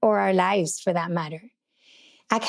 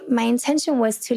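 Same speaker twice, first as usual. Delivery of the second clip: ordinary delivery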